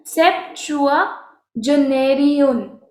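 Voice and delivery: सेप्टुआजेनेरियन said slowly, every syllable clear; slow careful pronunciation. Shown as a demonstration. Teacher modelling सेप्टुआजेनेरियन slowly for the class. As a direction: stretch सेप्टुआजेनेरियन out for learners. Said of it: "इसका pronunciation आप देखो".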